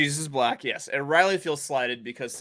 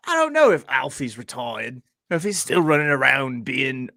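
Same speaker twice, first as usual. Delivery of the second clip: put-on hammy Michael Caine-style British accent; terrible British accent. Musing aloud about Alfie retiring, terrible British accent. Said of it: "That's my terrible British accent".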